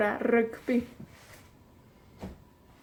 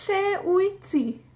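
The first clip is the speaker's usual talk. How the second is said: high, slow model question; 6 semitones higher, slower than usual. Shown as a demonstration. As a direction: sing out Lle wyt ti as a prompt. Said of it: "model question, put-on".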